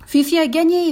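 scandé bien plus haut et fort; cri de victoire. Acted. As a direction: scandez comme la foule en liesse. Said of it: "crièrent tous les spectateurs". (les spectateurs scandent la victoire, très fort)

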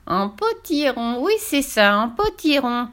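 slow high gloating witch. Acted. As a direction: savor the idea. Witch gloats over pumpkin.

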